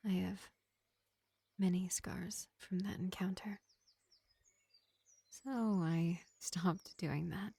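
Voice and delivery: lightly voiced vampire healer, projected above the chatty voice; gentle vampire medic. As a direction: play a measured vampire reassuring a wounded elf, with long pauses. Plays vampire medic to an elf.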